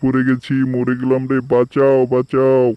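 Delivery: high, wailing cries from the bottom of a well; trapped tiger wailing for help. Acted. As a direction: howl in panic for rescue.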